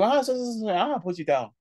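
mock fluster, then 不知道; hesitant stalling. Shown as a demonstration. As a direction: stall like you're caught off guard, then shrug. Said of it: "they ask you".

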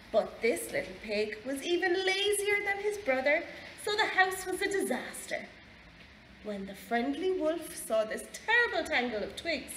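plain reading voice

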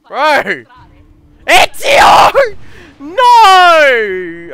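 furious screaming at the game; raging gamer. Real, not acted. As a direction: yell it in full rage. Character falls, gamer rages.

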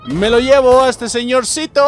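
high, loud gleeful goodbye; a cheeky alien gloating over his catch; gleeful villain. Real, not acted. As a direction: gloat as you carry him off. Abducting a man, gloating.

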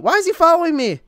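high, loud panicked cry. Real, not acted. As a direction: yelp it out in alarm. Chased by armed player.

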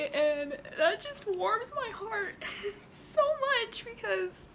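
moved to real tears, words stretched out; choking up with gratitude; tearful, touched. Real, not acted. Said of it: "why I'm crying".

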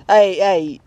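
ordinary talk, nothing marked

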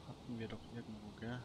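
ordinary delivery

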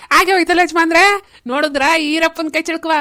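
bursts out laughing, then loud, high and thrilled; joyful excited laugh. Acted. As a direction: shout the good news with delight. Overjoyed that master speaks again. (ಸಂತೋಷದ ಜೋರು ನಗುವಿನ ಉದ್ಗಾರ)

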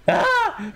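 short, very high-pitched yelp; high scream. Real, not acted. Player shot at in the game, high scream. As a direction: let out a startled squeal.

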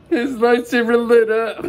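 high, loud words breaking into laughter; laughing. Real, not acted. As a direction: squeal it through real laughter. Cracking up at clip.

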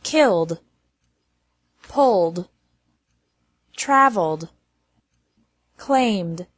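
"killed", "claimed", "burned" with D straight after L, M or N; careful word-list demo, "explained" closing straight from N to D. Shown as a demonstration. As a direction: end "pulled", "jammed" and "warned" on D, no extra syllable. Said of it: "E D is pronounced like D".

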